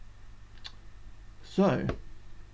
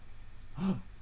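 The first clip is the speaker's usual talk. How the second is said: delighted gasp; 10 dB quieter than usual. Acted. Finds provisions.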